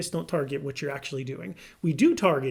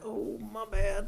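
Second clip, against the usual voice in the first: sheepish husband; 4 semitones higher, slower than usual. Retold or imitated. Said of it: "I'm like".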